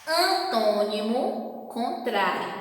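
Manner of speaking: slow, spaced-out recitation of the pairs; slow memory drill. Shown as a demonstration. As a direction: drill it slowly for the class to remember. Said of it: "é só lembrar". (ditado lento para memorizar)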